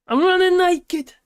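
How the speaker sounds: high, slow, shouted comic yell; Will Ferrell impression. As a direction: belt it out like a goofy comedian. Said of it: "Was that Will Ferrell?".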